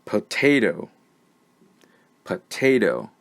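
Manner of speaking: "potato" said alone as puh-TAY-toe; careful word model. Shown as a demonstration. Teacher saying the word so learners find the schwa, careful model. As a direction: dictate "potato" with a reduced first syllable, then repeat. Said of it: "I'm going to say the word".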